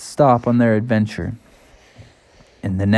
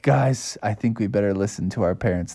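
softer, lower, sheepish aside; subdued cubs; 3 semitones lower, 7 dB quieter than usual. Acted. Cubs reluctantly giving in.

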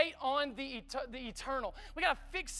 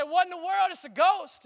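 higher, louder frightened cry; mock panic; 6 semitones higher, 6 dB louder than usual. Disciples in the boat shouting at a ghost, mock fear. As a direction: play the disciples spooked by a ghost. And they say.

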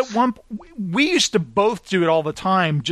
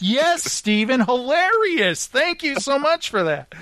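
sarcastic; 7 semitones higher, slower than usual. Retold or imitated. Sarcastic reply to friend's joke.

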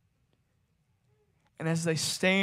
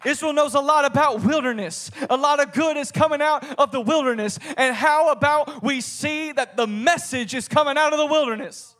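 raised, driving preacher's voice; forceful preaching; 5 semitones higher, 4 dB louder than usual. Acted. Preacher declaring John's message out of the wilderness, forceful. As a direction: proclaim the scripture with force.

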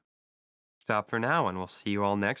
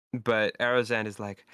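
normal talking voice

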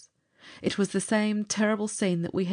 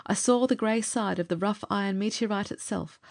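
ordinary delivery, nothing marked

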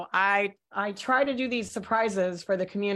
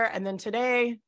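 usual speaking voice

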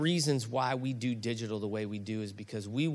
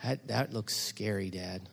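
put-on soft, slow, hesitant child's worry; a hint of a scared little boy; 7 semitones lower, 8 dB quieter, slower than usual. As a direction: voice the small son's nerves quietly. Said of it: "And he goes".

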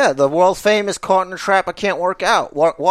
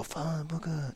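quiet; 18 dB quieter, slower than usual. Retold or imitated. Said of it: "I go".

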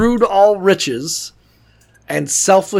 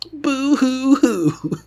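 fake crying; 7 semitones higher, much slower than usual. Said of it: "being like".

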